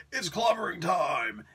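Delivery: a drawn-out catchphrase yell at moderate volume; Ben Grimm. Retold or imitated. Reviewer quoting a superhero's famous catchphrase, imitated scream. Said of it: "Ben Grimm screams out his, his famous".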